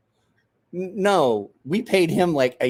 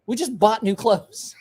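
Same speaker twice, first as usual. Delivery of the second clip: slow, high laughing delivery; 8 semitones higher than usual. Real, not acted. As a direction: say it through laughter. Laughs at own memory.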